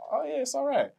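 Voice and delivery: high-pitched imitation of a listener's polite brush-off; mock lukewarm approval. Imitating someone at the back hearing the music, mock lukewarm. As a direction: put on a high, noncommittal voice for the listener.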